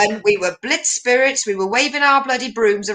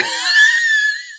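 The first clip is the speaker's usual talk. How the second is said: shrieking laugh. Real, not acted. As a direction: burst out in a squealing cackle. Shrieking laugh at own joke.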